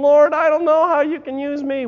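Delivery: high, loud, protesting Moses; reluctant Moses. Acted. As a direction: plead your way out of the call. Moses voiced.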